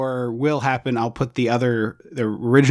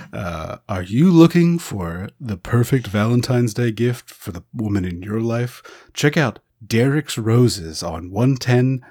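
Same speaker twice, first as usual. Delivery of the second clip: slow, smooth radio ad read. Shown as a demonstration. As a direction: sell it like a late-night radio spot. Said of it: "late night radio spots".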